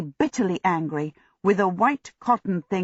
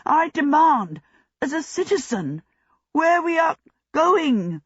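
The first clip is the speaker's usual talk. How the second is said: high, indignant demand; strong protest; 10 semitones higher than usual. A narrator's reading. Prisoner demanding answers from soldiers marching him, high-pitched protest. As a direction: object in a much higher, affronted voice. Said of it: "he was protesting very strongly indeed".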